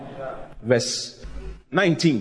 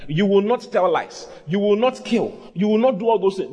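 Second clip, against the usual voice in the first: ordinary talk, nothing marked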